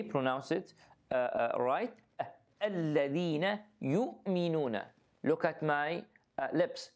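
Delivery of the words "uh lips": ordinary talk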